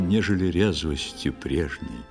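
ordinary talk, nothing marked

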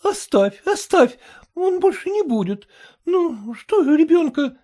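protect your son from his father; pleading mother. A narrator's reading. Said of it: "вступилась за сына мать".

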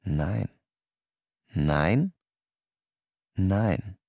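ordinary delivery

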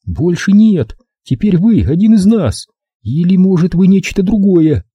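high-pitched challenge; high, suspicious and defiant; defiant captive woman. A narrator's reading.

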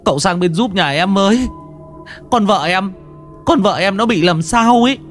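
high, panicky pleading voice; frantic husband. Acted. Husband begging the master to save his wife, high panicked. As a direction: beg for help, scared for his wife.

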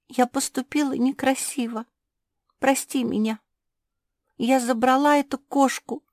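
high, pleading small dog voice; high-pitched contrite character; apologetic little spitz. Acted.

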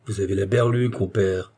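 plain reading voice

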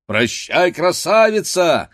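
loud, teasing shout rising high; loud cry. A narrator's reading. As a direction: cry out a mocking goodbye. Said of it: "вскричал другой".

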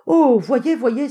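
loud, high cry of amazement; sudden exclamation. Acted. Hopkins pretending his uncle is reappearing, sudden exclamation. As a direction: burst out as if seeing a marvel. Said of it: "s'écria Hopkins brusquement".

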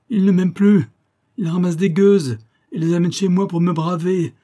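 high, loud jealous tirade; vehement outburst. A narrator's reading. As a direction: rail furiously at the top of your voice. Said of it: "par sa véhémence". (tirade furieuse, aiguë et forte)

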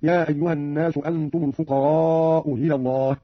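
"الفقراء إلى" with the hamza of "إلى" eased; softened second hamza. Shown as a demonstration. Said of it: "بتسهيل الهمزة الثانية أو إبدالها واوا".

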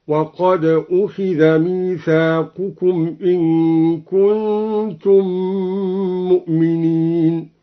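أخذ said as "ukhidha", with ميثاقكم ending in -u, chanted slowly; slow tartil, أخذ as ukhidha and ميثاقكم as mithaqukum; أخذ in passive form. Shown as a demonstration. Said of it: "قرأ الدوري بضم الهمزة وكسر الخاء". (تلاوة بطيئة: أُخِذَ ميثاقُكم)

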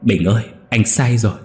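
begging the dead brother to wake up, sobbing; anguished wail building to a near scream; loud wailing cry. Acted. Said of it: "Kha khóc váng lên".

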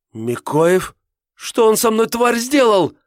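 climbing from shocked question to frantic cry; hysterical scream. A narrator's reading. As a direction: voice jumps up into a shriek. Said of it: "Голос Ольги сорвался на истерический крик".

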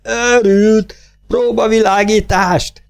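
high, drawn-out fortissimo cry; screaming yell. A narrator's reading. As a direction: shriek the order in a frenzy. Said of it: "Az üvöltés fortissimóba sikolyodik". (fortissimóba csapó, magas sikoltás)

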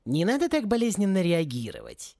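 mildly hurt protest; offended. A narrator's reading. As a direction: protest with a touch of wounded dignity. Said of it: "обиделся летописец".

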